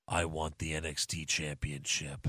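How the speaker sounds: low, slow, quiet menacing wrestler. Retold or imitated. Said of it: "the first time he talks is".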